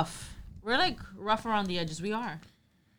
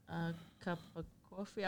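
very soft, hesitant retold question; embarrassed; 3 semitones lower, 19 dB quieter than usual. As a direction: say it small, unsure you said it right. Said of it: "I felt embarrassed. I thought I said something wrong".

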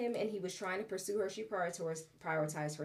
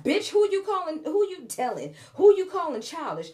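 loud, high mock outrage; going off; 8 semitones higher, 9 dB louder than usual. Viewer going off at the man on screen, loud mock outrage. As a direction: tear into him with laughing fury. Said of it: "this was me going off".

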